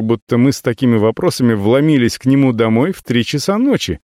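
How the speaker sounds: ordinary delivery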